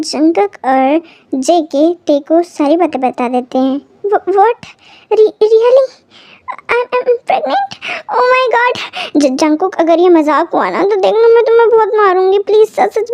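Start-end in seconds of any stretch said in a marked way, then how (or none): 4.0-8.8 stunned disbelief. Acted. Shocked at pregnancy news.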